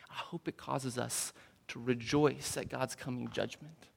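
whisper. Real, not acted. Quiet hopeful aside.

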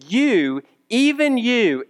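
emphatic preacher's insistence. Acted.

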